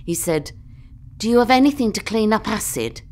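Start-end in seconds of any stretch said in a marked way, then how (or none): 1.2-3.0 quick, louder, higher flustered question; hurried, bothered request for help; all of a bother. Retold or imitated. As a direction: imitate a captain rushing over in a bother. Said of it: "came running over all of a bother".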